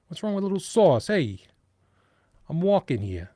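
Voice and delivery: loud, brash New York street voice; New York accent. Shown as a demonstration. Host hamming up his New York accent for the viewers, loud and brash. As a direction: play up a Brooklyn tough guy, loud. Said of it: "constrain the New York accent".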